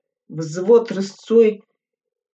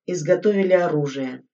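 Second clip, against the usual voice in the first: ordinary delivery, nothing marked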